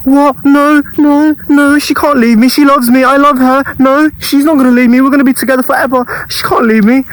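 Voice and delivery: mock the lovesick guy begging she won't leave; high panicked denial. Friend expected him to panic over his girlfriend leaving, high frantic pleading. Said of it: "expecting me to be like".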